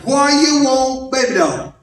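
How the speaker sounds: put-on "Why you want" stretched slowly, then "baby doll" dropping; slowed demonstration. Shown as a demonstration. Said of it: "Did you hear that?".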